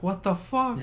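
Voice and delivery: high, baffled mock outburst; stunned driver. Driver gets out to a smashed windshield, imitated shock. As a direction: imitate her bewildered shock. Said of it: "with this look on her face".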